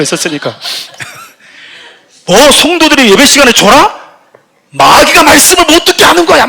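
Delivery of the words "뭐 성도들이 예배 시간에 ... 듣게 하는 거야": high, loud indignant preacher shout; fiery preaching rebuke. Retold or imitated. Preacher scolding members who doze in worship, loud high rebuke. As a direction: thunder at the sleepers from the pulpit.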